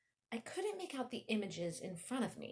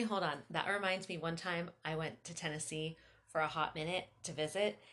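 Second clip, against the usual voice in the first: ordinary delivery, nothing marked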